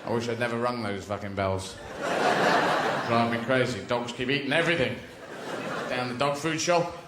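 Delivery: a lightly voiced Pavlov, grumbling; Professor Pavlov. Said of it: "Professor Pavlov".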